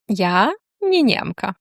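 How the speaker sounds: ordinary talk, nothing marked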